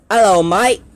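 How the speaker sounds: cheery try at an Australian accent. Shown as a demonstration. Vlogger showing off accents to viewers, Australian accent. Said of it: "Here's my Australian".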